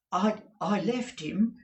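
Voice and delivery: high, slow stammer; hesitant client. Acted. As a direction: falter. Mike answering.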